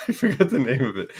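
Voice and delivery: laughing through the words, quick. Real, not acted. Host laughing at forgetting a viewer's town. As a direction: giggle while admitting you forgot.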